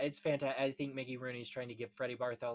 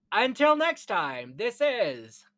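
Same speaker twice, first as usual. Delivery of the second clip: louder, slow announcer sign-off; podcast sign-off; 8 semitones higher, 12 dB louder, much slower than usual. Acted. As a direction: close the show like a radio host.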